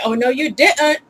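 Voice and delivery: mock diva with a glottal stop in didn't; imitated diva's indignant line; sassy diva. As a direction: break didn't in the middle, diva-style. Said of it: "hear a diva say".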